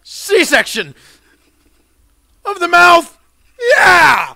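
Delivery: high, very loud battle cry; triumphant yelling. Pretend. Player crowing over a game kill, triumphant high shouting.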